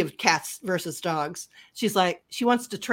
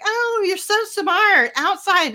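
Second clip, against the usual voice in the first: put-on much higher, slow baby-talk praise; praising a dog; 13 semitones higher, 4 dB louder, slower than usual. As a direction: gush at the dog like a proud owner. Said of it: "And they're like".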